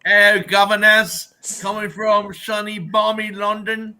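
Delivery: over-the-top Cockney greeting; mock Cockney accent. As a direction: play a cheeky Cockney geezer greeting everyone. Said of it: "like, this Cockney accent".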